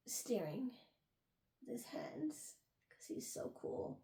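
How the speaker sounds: soft, breathy, mock-cool murmur; whispered aside. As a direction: whisper it like a secret joke.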